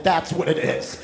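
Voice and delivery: normal talking voice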